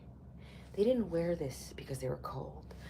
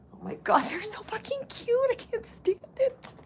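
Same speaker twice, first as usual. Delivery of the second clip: much higher, squealing with delight; gushing; 6 dB louder than usual. Real, not acted. Gushing to viewers over cuddling dogs, high squeal. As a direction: squeal like it's too cute to bear.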